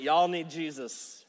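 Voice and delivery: slow Southern drawl; Southern accent. Shown as a demonstration. Preacher quotes a TV show line to the room, Southern accent. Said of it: "To put it in a Southern accent".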